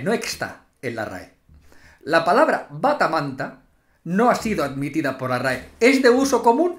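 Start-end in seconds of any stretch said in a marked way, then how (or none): 0.0-1.3 much lower and softer repeat; low soft echo. Shown as a demonstration. Vlogger repeating the outrage low and soft. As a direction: drop it to a low mutter.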